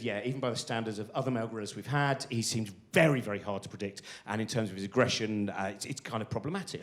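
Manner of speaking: a keeper mildly worried about the gorilla; low, soft zookeeper voice. Retold or imitated. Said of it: "She said".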